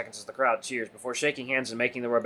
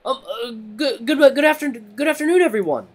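high, stammering rabbit voice, building louder; nervous announcer; 11 semitones higher, 7 dB louder than usual. A narrator's reading. Timid rabbit greeting a noisy crowd by microphone, nervous stammer. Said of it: "Theodore, the nervous rabbit".

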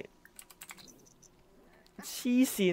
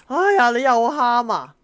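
loud and slower, fed up with the crying; exasperated cry; 6 semitones higher, 11 dB louder than usual. Real, not acted. Scolding the crying Sims baby, loud and exasperated.

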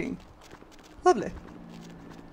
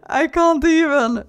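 slow, high, loud through laughter; helpless laughter; 5 semitones higher, 5 dB louder than usual. Real, not acted. Player cracking up at the chaos on screen, helpless laughing.

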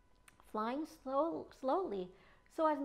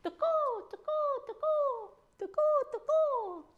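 high, loud croaking tuko calls; gecko hiccup call; 9 dB louder than usual. Acted. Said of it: "hiccuped the gecko".

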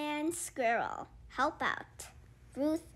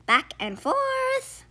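sing-song rocking; 12 semitones higher, 4 dB louder than usual. Acted. Fox rocks rhythmically.

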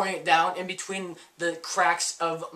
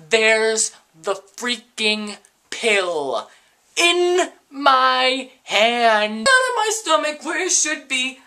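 slow, loud, much higher disbelief; exasperated outburst; 7 semitones higher, 7 dB louder, much slower than usual. Real, not acted. [name] fumes at the pill that came back up, slow loud higher disbelief. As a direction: drag it out in outrage.

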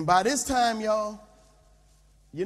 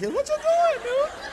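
comic falsetto squeal, louder; squeaky high voice; 4 dB louder than usual. Acted. Pastor panicking at his trainer's speed, squealing very high. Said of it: "My voice starts getting high like".

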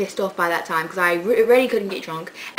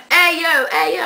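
imitated street call, high and raised; 7 semitones higher, 5 dB louder than usual. Crazy guy outside the police station, calling out to her. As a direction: call out like a stranger wanting attention.

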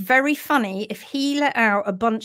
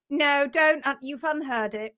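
usual speaking voice

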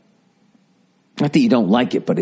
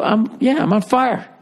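resigned burning soul; 11 semitones higher, slower than usual. Retold or imitated. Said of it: "Then if you're in hell".

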